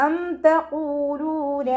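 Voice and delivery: അം chanted slow and high with the meem clear; correct tajweed reading. Shown as a demonstration. Teacher shows the right reading, clear meem in chant. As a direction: sing അം തഖൂലൂന with meem unmerged. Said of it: "എന്ന് ഓതരുത്".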